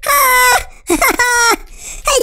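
squealing hype laughter; shrieking excited laugh. Real, not acted. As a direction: laugh out in a high, loud shriek. Channel intro, shrieking laughter.

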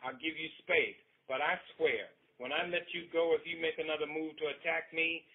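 loud, high, stern warning. Acted. Husband pinning his wife down, a loud stern warning. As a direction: lay down the law. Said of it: "he warned".

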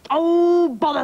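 loud, high, drawn-out frustration; exasperated outburst. Acted. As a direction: burst out in storybook exasperation. King thwarted again, loses patience.

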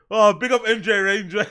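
ordinary talk

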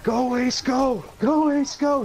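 high excited cheering. Real, not acted. Cheering teammate on mid-fight.

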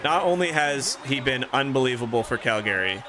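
usual speaking voice

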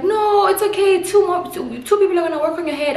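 high, eager stylist voice; imitating the stylist. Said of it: "they were like".

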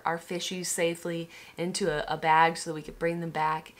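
ordinary talk, nothing marked

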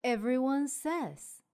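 plain reading voice

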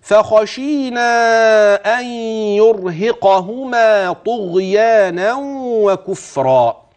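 فخشينا very slow with a heavy, full kha; slow chanted فخشينا with emphatic kha. Shown as a demonstration. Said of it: "من الخاء المفخمة".

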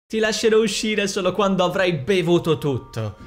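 high, forceful shouted threat; villain's yell. A narrator's reading. Demonia to the sister nailed in the barrel, yelling. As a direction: yell it at someone locked away. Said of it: "urlò Demonia".

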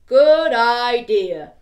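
slow, loud, booming big skeleton. Acted. Storyteller to children, as the big skeleton, slow and loud.

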